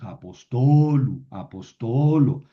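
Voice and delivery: a-pos-TO-lu, a-pos-TO-lo, slow; slow demonstration, "apostolo" with a long stressed TO; stress moved to penultimate. Shown as a demonstration. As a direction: stretch and punch "to" in "apostolu" and "apostolo". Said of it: "tengo que moverlo a la penúltima".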